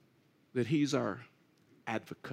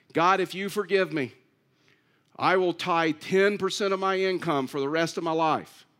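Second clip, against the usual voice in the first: loud, insistent mock bargaining with God; 3 semitones higher, 5 dB louder than usual. Preacher acts out a sinner promising God tithes and church, bargaining. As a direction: pile up earnest promises, hammering each one. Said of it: "we start bargaining with God".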